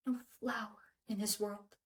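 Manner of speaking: normal talking voice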